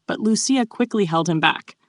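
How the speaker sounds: ordinary delivery, nothing marked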